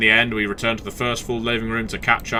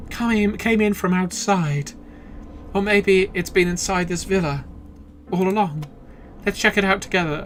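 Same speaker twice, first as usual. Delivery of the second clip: put-on high girl voice; 8 semitones higher than usual. Voicing the girl companion.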